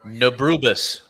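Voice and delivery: Nabrubis said as suburban backwards; Nabrubis, the word suburban spoken backwards; backwards speech. Shown as a demonstration. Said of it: "I'm gonna say it backwards".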